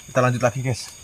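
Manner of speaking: normal talking voice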